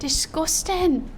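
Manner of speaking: high, drawn-out mock British; British accent. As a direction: echo it in an exaggerated, stretched British accent. Echoes the mock British word.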